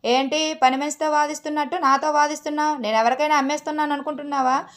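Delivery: normal talking voice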